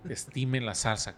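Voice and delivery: slightly slow, mild repeat of dad's warning; father's slow warning. Retold or imitated. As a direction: echo the thrifty father's phrase, a bit drawn out. Repite la frase del padre. (repitiendo la frase del papá, algo lento)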